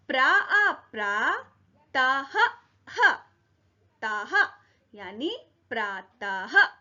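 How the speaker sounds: teacher drawing out each syllable of प्रातः; slow syllable drill. Shown as a demonstration. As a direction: sound out प्रा आ प्रा then ताह, slowly and clearly. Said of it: "आप सब भी मेरे साथ उच्चारण कीजिए". (अक्षर-अक्षर धीरे-धीरे बोलना)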